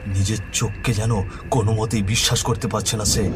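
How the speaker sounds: ordinary delivery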